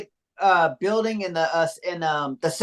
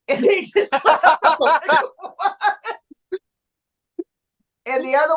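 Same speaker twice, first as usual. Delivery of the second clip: laughing; 8 semitones higher than usual. Real, not acted. Laughing at own fart story.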